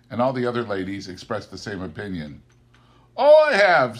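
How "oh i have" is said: high, loud cheerful retort; emphatic retort. A narrator's reading. Nephew answering the ladies with a merry protest. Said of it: "said Scrooge's nephew".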